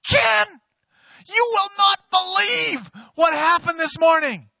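very high, loud, slow excited shout; excited usher. Retold or imitated. Head usher running up to the pastor, excited shout. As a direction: burst in with big news.

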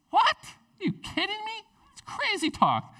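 very high incredulous protest; hammed-up squeaky "you can't be serious" reaction; mock disbelief. Moses reacting to God's plan, incredulous.

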